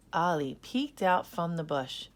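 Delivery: ordinary delivery, nothing marked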